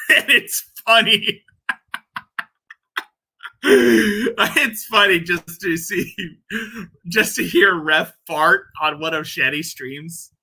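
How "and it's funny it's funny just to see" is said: laughing. Real, not acted. Laughing at fart stream memory.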